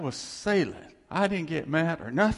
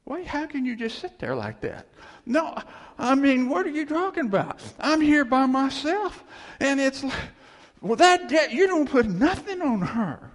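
indignant protest; 8 semitones higher, 6 dB louder than usual. Retold or imitated. Arguing with God in prayer.

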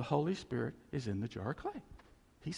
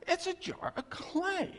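mocking; 13 semitones higher, slower than usual. Preacher mocks fragility.

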